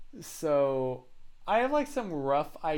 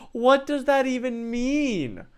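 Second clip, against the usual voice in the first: imitating Susan; 9 semitones higher, 6 dB louder, slower than usual. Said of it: "And she's like".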